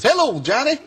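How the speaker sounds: high, sing-out phone greeting; high-pitched mock-friendly hello; imitated cheery reverend. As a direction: mimic a delighted man recognising the caller. Reverend greets the disguised caller knowingly.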